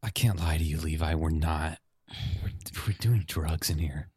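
low, quiet confession. Acted. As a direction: drop the voice and confess. Confession to manager.

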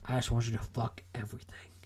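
soft, low seductive whisper; Slaanesh. Acted. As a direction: whisper it like a tempting god.

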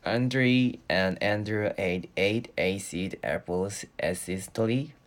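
very slow, careful tongue twister, word by word. Shown as a demonstration. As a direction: pick your way slowly through each word. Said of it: "발음 연습하거든요".

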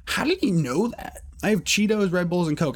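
mock shocked disbelief. Shocked inner reaction.

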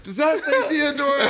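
high, exaggerated taunting question with a laugh; mocking question. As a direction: rub it in like talking to a child. Repeated taunt at driver.

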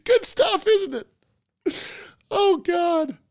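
high, squeaky speech through laughter; cracking up. Real, not acted. Host wheezing over the viral clip, cracking up. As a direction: squeeze the words out while laughing.

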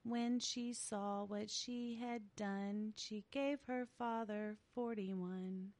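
put-on slow, eerie child singsong; creepy kid voice. Shown as a demonstration. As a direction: chant it like a creepy little kid. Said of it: "sing it in your creepy kid voice".

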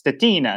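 "Stetina" as steh-TEE-na; Italian-style pronunciation. Retold or imitated. Guest quotes Italian racers' reading, "Stetina" as steh-TEE-na. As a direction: give "Stetina" three syllables, stressing TEE. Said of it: "all the Italians racing in Europe would think".

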